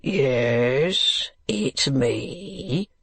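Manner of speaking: gruff, drawn-out reply. Acted. Unseen man answering old gentleman from below wall, gruff. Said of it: "replied a gruff voice".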